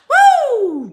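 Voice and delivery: high, loud "woo" cheer; excited whoop. Real, not acted. Cheering the sister-wives dream, loud high whoop. Cheering own polygamy fantasy.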